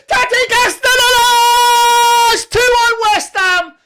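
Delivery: screaming the scorer's name at full pitch; high, loud, stretched goal scream. Real, not acted. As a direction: roar the goal like you've lost your mind.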